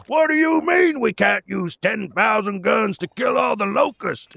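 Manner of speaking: high, loud caricature of a shouting general; hard-ass military general. Acted. General outraged at limits on guns, hammed-up military shout. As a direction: bellow it like an outraged video-game commander. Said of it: "hard-ass military general style character".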